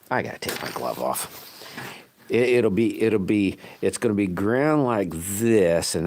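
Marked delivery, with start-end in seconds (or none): none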